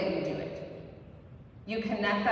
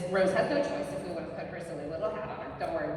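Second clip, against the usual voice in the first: ordinary talk, nothing marked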